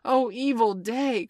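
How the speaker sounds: loud, high, drawn-out lament; hammed-up pirate's outcry; woeful cry. A narrator's reading. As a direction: wail it with melodramatic dismay. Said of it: "cried Starkey".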